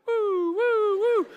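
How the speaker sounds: high wailing siren woo; sing-song siren noise; imitated police siren. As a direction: mimic a cop car's siren.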